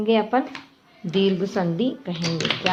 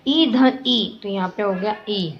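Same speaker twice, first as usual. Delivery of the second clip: usual speaking voice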